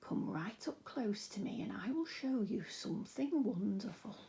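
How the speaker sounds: put-on slow, soft whisper of an old man; creepy old man, whispered; slow creaky old man. A narrator's reading. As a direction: lure the boy in with a creaky whisper. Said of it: "very slow and creaky".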